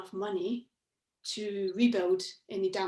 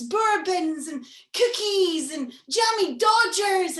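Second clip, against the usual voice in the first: usual speaking voice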